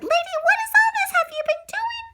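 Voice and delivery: high, reproachful scolding of the dog; scolding owner. Acted. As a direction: tell off a naughty pet. Jim turned to Lady and said.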